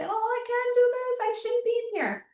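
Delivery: high, thin, put-on voice of a body that wants out; panicky inner voice. Coach voices her body refusing, high panicky voice. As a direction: squeak it out like a frightened inner child.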